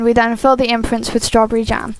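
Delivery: ordinary delivery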